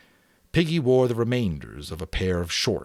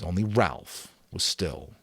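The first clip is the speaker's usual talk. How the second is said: plain reading voice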